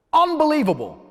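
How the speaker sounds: high, loud disbelief; outraged disbelief at the Met Police; exasperated outburst. Real, not acted. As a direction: throw up your hands and cry it out.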